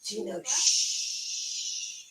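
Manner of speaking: slow whispered shush; a drawn-out whispered shh; hushing whisper. Real, not acted. Hushing child during live stream.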